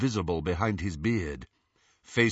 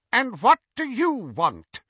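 higher, louder, full of contempt; contemptuous; 13 semitones higher, 6 dB louder than usual. A narrator's reading. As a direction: look down on him with every word. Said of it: "with as much contempt as she could muster".

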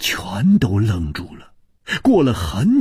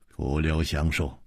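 usual speaking voice

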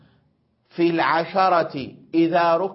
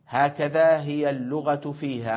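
ordinary talk, nothing marked

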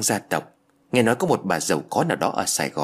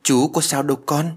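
higher voice, mildly taken aback; surprised; 5 semitones higher, 4 dB louder than usual. A narrator's reading. Man to the clinging little girl, surprised. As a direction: answer the child in puzzled surprise. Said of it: "Văn cũng phải ngạc nhiên".

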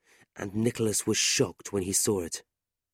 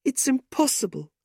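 upset. A narrator's reading. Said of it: "they were both crying".

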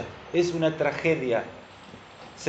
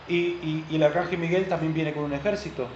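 ordinary talk, nothing marked

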